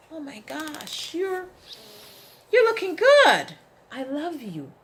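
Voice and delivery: high, loud gushing over yourself; praising yourself in the mirror, high and gushing; gushing self-praise. Pretend. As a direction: rave at your own reflection. Self-affirmation voiced to mirror.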